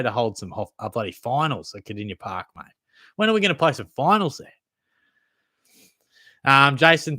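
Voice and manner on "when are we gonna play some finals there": exasperated call. Real, not acted. Rant about finals at Kardinia Park.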